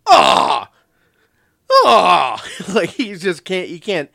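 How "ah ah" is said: very loud mock gasps of disbelief; Skull's stunned cries. Sidekick who can't believe what he hears, loud stunned cries. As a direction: blurt out a big stunned "ah". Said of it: "Skull's just like".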